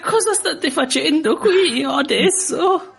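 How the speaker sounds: put-on high, slow fussy old lady. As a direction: play a shrill-sounding elderly signora. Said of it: "Allora, facciamo una scena". (voce da vecchietta)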